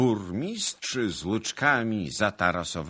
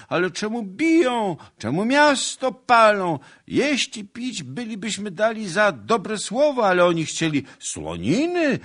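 very high, loud, whining lament; wailing complaint; 10 dB louder than usual. A narrator's reading. Townsmen tearfully protesting soldiers' abuses, high wailing. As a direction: wail each grievance at the top of the voice. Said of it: "Kiedy to nie zaczną plakać?".

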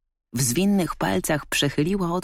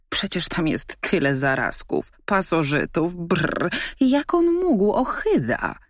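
disgusted dog; 3 semitones higher than usual. A narrator's reading.

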